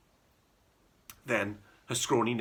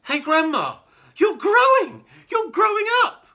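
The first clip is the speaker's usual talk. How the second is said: put-on high, loud boyish cry of alarm; astonished boy calling out; excited shout; 11 dB louder than usual. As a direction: shout up at her in wonder. Child shouting at growing grandma.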